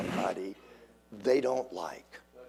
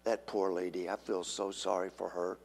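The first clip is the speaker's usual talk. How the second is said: mock pity; 7 semitones lower, 10 dB quieter than usual. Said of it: "But it's like".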